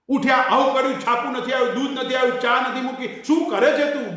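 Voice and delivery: hammed-up household complaint; mock morning rant. Mimics angry morning grumbling.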